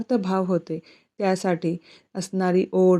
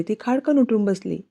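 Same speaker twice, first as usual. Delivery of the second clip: usual speaking voice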